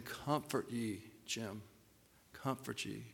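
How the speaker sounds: very soft, slow, hushed words; gentle voice of God. Retold or imitated. Pastor voicing God comforting him personally, hushed and slow. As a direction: say it low and tender, like God speaking to you alone. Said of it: "God's voice s-, saying".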